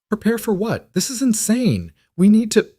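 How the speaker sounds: very high, disbelieving outburst cut short; alarmed protest. A narrator's reading. Doctor objects to the plan as insane, alarmed protest. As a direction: protest in a high-pitched panic, breaking off mid-sentence. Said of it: "Emily cut him off".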